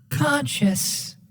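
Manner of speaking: ordinary talk, nothing marked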